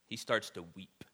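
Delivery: ordinary delivery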